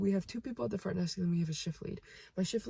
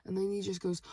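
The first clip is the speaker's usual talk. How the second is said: usual speaking voice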